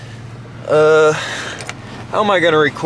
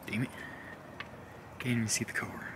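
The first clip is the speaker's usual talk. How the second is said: whispering; 3 semitones lower, 22 dB quieter, faster than usual. Real, not acted. Dark car early morning, hushed.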